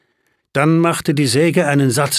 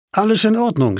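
higher-pitched character line, falling at the end; lightly voiced Emily; 7 semitones higher than usual. A narrator's reading. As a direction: voice Emily's quick assurance in a raised pitch. Emily's line voiced within the narration. (Emilys Ausruf, höhere Stimme)

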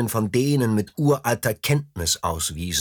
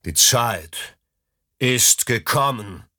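deep throaty sea serpent; slower than usual. A narrator's reading. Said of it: "dröhnte er mit seiner tiefen, kehligen Stimme".

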